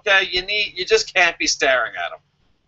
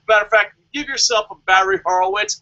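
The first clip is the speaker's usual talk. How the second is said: normal talking voice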